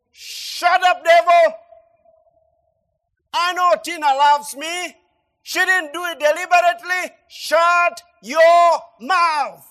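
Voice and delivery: shouting down the devil, high and loud; a furious shout at the tempting voice; loud rebuke. Retold or imitated. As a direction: rebuke it at full force, slowing on the last words. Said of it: "I said it loud".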